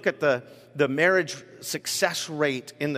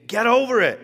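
loud, higher dismissive yell; 8 semitones higher, 7 dB louder than usual. A narrator's reading. Crowd yelling at the beggar, loud and dismissive. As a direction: snap it at him. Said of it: "the people in front yelled at him".

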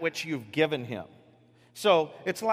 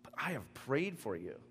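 soft tender; 2 semitones lower, 9 dB quieter than usual. Acted. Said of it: "I can rely on".

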